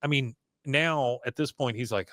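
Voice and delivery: usual speaking voice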